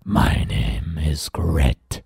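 deep, very slow monster rasp; low, drawn-out monster voice; Grit. Acted. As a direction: introduce yourself in a growly creature voice. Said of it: "said the monster".

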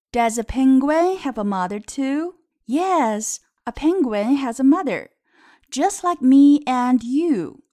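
slow, lilting picture-book reading; read-aloud to children. Shown as a demonstration. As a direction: read it out clearly for small learners.

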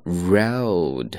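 road with a full, rounded double vowel; model road. Shown as a demonstration. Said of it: "正确的发音是这样". (饱满的双元音读road)

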